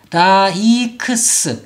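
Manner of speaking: 라이크스 broken slowly into four syllables. Shown as a demonstration. Teacher breaks down the common mistake, syllable by syllable. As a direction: spell out 라-이-크-스 with full vowels. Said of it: "이렇게 발음하는 경우가 아주 많아요".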